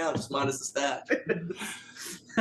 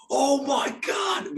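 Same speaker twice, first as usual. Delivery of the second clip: high, drawn-out gushing cry; thrilled exclamation stretched right out; excited fan; 9 semitones higher, much slower than usual. Retold or imitated. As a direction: mimic a guy overjoyed at a shared favorite. Acting out excited fellow music fan.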